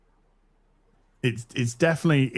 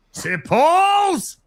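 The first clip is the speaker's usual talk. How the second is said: delighted squeal; 4 dB louder than usual. Real, not acted.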